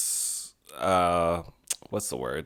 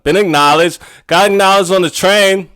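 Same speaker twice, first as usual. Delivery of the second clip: excited; 7 semitones higher, 7 dB louder than usual. Real, not acted. Excited news of being recognized.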